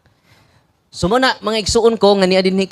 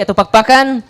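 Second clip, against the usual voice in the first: ordinary delivery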